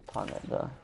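whispered to himself; whispering. Real, not acted. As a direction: breathe it out under your breath. Talking to himself while placing.

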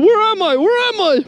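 high, hammed-up little frog panic; panicked frog. Frog pulled out of the mud, voiced in high mock panic. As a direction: voice the frog asking where it is. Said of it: "He's freaking out. He's like".

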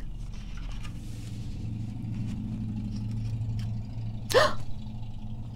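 long high breathy gasp; sharp whispered gasp. Acted. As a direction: gasp in shock as you fall. Tiny falls.

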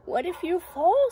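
high, loud worried question; little doubting voice. Acted. Little voice inside Zoe's head questioning her, high and doubting. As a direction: pipe up with a high, nagging doubt. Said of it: "says the little voice".

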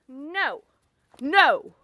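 ordinary delivery

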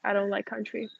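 ordinary talk, nothing marked

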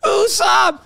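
high, loud mock-anguished shout; melodramatic cry. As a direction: wail the name like an anime farewell. Mimics tearful flashback farewell cry.

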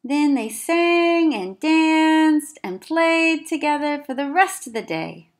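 high, loud, slow storybook lilt; cheerful storyteller drawing it out; lively. A narrator's reading. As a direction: sing it out playfully.

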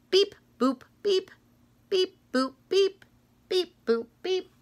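high chirpy beep-boop noises; scanner beeps. Acted. Reader mimicking the book scanner, playful beeps. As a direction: play the checkout scanner.

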